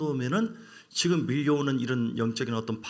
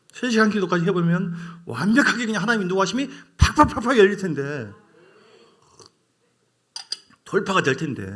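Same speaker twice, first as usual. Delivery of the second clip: louder, fervent preaching build; rising preaching; 4 semitones higher, 5 dB louder than usual. Real, not acted. Preacher urging the church to pray three hours, loud and fervent. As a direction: drive the promise home to the congregation.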